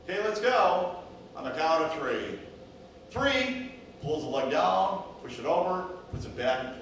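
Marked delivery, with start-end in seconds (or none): 0.1-3.7 raised, high call to action; acted brisk countdown. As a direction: brace someone for the painful count. Counting before setting the leg.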